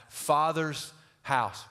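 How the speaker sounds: slow, weighty father's house; drawn-out stress on "father's house"; emphasized phrase. Shown as a demonstration. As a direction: lean hard on "father's house". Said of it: "Hey, underline that".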